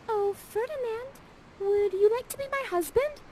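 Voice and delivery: put-on very high, breathless girlish proposal; swooning Miranda. Miranda proposing to Ferdinand, high and swooning. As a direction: gush the question like a smitten girl. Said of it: "Miranda's like".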